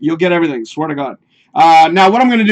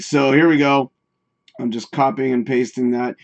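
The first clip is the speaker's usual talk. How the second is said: ordinary talk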